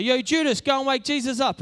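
loud, high mock shout to a mate; calling out. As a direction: holler the order across the boat. Acted disciple calls out to Judas.

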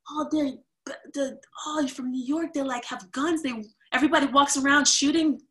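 high, loud mock alarm; lightly mocking exaggerated panic; mocking South Africans. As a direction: ham up their horror at New York. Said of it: "they were like".

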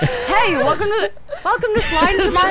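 giggly, breaking down mid-line; laughing. Real, not acted. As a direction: fight through the giggles. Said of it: "you had an episode".

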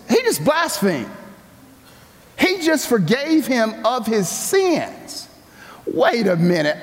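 high, shocked objection; outraged religious folks; indignant scribes. Retold or imitated. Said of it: "And they're like".

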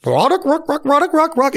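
high, rapid honking goose call by mouth; imitated goose call. Shown as a demonstration. Hunter calling at another approaching flock, imitated goose honks.

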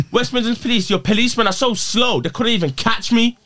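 high-pitched taunting call-out; mock taunt. Taunting West Midlands Police for a TikTok video, mocking call-out.

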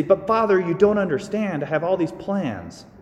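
a bride protesting to the priest; pleading bride. Retold or imitated. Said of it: "she'd say".

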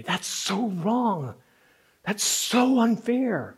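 slow, pained protest; acted indignation. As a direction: draw out the outrage slowly. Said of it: "This indignation rises in you".

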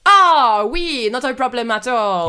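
hammed-up French waiter accent. Waiter serving a diner arsenic cocktails, French accent. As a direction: play a fussy French waiter, lilting.

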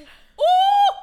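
high, loud fuming "ooh"; exasperated ooh. Acted. Friend imagining throwing a fist, fuming ooh.

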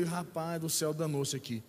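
low, soft muttered dismay; retold worried aside. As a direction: grumble it quietly to yourself. Retold worried thought to himself. (resmungo baixinho de preocupação, imitado)

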